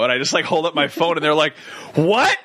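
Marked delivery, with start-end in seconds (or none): 1.9-2.4 high, startled question; imitated surprise. Cops surprised by the phone video, imitated astonishment. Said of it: "surprised by this".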